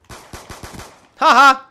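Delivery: triumphant laugh. Real, not acted. Gamer triumphs over enemy.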